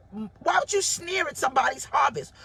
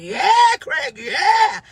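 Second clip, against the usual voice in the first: loud and high yeah for Craig; cheering a friend's engagement, loud and high; excited cheer; 6 semitones higher, 9 dB louder, much slower than usual. Retold or imitated. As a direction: cheer like you just heard great news. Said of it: "I was like".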